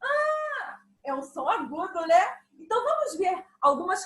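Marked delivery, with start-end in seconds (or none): 0.0-0.8 much higher and louder; a high-pitched greeting showing a high sound. Shown as a demonstration. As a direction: squeak it up high. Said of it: "E se eu fizer assim".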